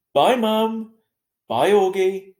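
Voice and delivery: calling out. A narrator's reading. Calling goodbyes.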